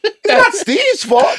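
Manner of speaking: laughing. Real, not acted. Laughing defense.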